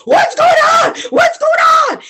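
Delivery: very high, loud panicked shout; alarmed yell. Acted. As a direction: bellow it in alarm. Farmer running in alarmed.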